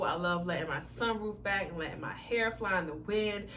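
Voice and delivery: normal talking voice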